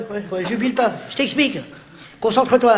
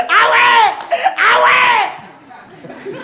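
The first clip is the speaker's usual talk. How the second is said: imitated stranger; 11 semitones higher, much slower than usual. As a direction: shout it like a stunned fan on the street. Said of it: "un mec vient voir, me dit".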